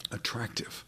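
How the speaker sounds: breathy whisper on the last word; whispered for emphasis. Real, not acted. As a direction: let the word fall away to a whisper. Preacher drops to a hush.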